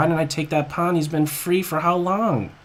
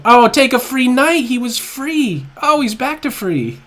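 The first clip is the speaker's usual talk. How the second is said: surprised excitement; 9 semitones higher, 8 dB louder than usual. Real, not acted. Surprised by free knight.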